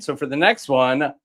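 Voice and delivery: ordinary talk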